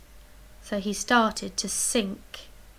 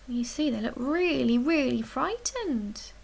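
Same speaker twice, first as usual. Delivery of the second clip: ordinary delivery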